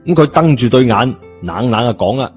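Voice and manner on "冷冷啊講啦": plain reading voice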